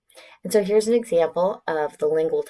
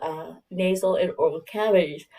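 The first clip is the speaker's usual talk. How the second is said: yawning through the words. Real, not acted. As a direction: talk mid-yawn. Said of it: "See, I'm yawning".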